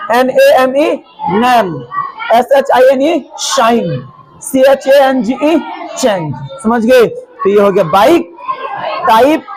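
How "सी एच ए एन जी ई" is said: usual speaking voice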